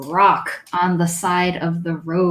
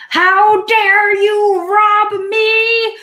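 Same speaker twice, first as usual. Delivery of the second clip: screaming goblin; 12 semitones higher than usual. Acted. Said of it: "it starts screaming".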